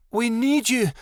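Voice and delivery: high, urgent plea; desperate high-voiced appeal; pleading. A narrator's reading. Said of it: "Midnight Star pleaded".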